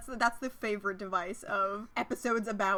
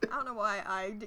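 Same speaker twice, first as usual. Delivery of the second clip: usual speaking voice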